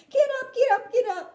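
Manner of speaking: high, loud gleeful call; booming get-up call; loud cry. Acted. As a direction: order them up off the floor. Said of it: "cried Mister Wonka, roaring with laughter".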